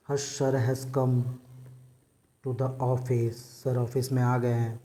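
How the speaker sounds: slow, soft warning that the teacher has come; hushed warning. Retold or imitated. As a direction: hiss a warning to the class. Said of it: "तुम लोग बोलते हो ना".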